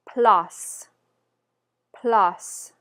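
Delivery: loud French "place" with open "ah" vowel; French pronunciation. Shown as a demonstration. Teacher giving the next word twice for repetition, French pronunciation. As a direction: model "place" as "plahss", one word at a time. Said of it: "the next word is".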